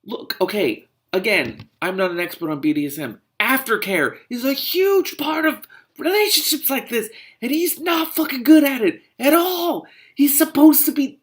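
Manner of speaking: high, loud outrage building; exasperated rant. Real, not acted. Reader rants about missing aftercare, loud exasperation. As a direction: climb from insistence to real yelling.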